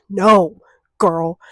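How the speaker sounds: loud, punchy denial; emphatic no. Real, not acted. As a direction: shut it down loud.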